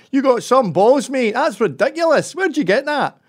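loud, high-pitched Glaswegian; Glasgow accent. Retold or imitated. Glaswegian locals ribbing someone in uniform, mock loud Glasgow accent. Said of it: "You see, in Glasgow".

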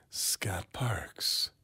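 slow drawn-out breathy whisper; dragged-out whisper. Pretend. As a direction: draw out each word in a hush. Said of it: "you really dragged that out".

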